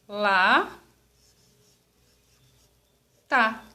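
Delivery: "Lá, tá" as two separate syllables with a long pause. Shown as a demonstration. Teacher showing pupils how to split lata, syllable by syllable. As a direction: split "Lá, tá" slowly, each syllable on its own. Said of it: "como eu separo a palavra lata?".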